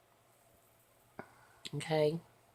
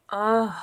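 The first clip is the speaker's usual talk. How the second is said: yawn groan; 5 semitones higher, 12 dB louder than usual. Real, not acted. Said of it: "y'all are making me yawn".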